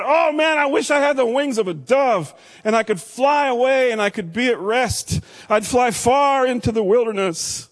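David's anguished cry. Acted. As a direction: wail it like a desperate man. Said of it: "But David said".

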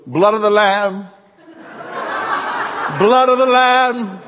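slow, loud preacher cry; Mossy preaching. Retold or imitated. Imitating Mossy proclaiming over his bloody face, loud and slow. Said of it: "He goes".

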